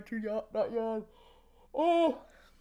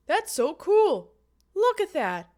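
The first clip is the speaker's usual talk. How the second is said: excited; 5 semitones higher, 11 dB louder, slower than usual. Real, not acted. Repeated excited exclamation.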